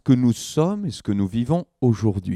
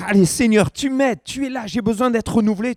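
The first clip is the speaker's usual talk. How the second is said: high-pitched, fervent imitated plea; urgent prayer; 8 semitones higher than usual. Struggling believer rallying to ask the Lord for renewal, urgent prayer.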